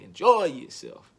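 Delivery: a hint of [name], slow and loud; [name] impression. Quoting [name], slow loud call. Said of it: "like [name] say".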